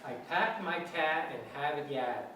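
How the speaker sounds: Boston accent with every R dropped; teacher's Boston accent demo. Shown as a demonstration. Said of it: "The way they say it up in Boston".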